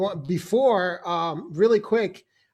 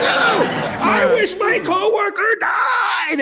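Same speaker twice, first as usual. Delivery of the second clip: high, drawn-out shouted incantation; hammed-up screaming wish, stretched out; mock curse; 13 semitones higher, 5 dB louder, much slower than usual. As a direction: bellow it like a comic voodoo curse. Acting a curse on the coworker.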